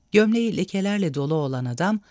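plain reading voice

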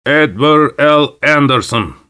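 shouted name. A narrator's reading. Kroner summons Anderson from the crowd, shouting. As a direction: call out the name for all to hear. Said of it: "diye bağırdı".

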